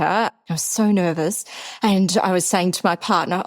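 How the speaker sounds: ordinary talk, nothing marked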